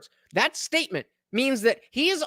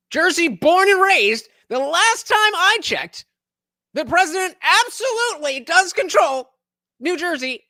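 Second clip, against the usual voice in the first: emphatic loud; 7 semitones higher, 5 dB louder than usual. Real, not acted. Emphatic rant, loud and slow.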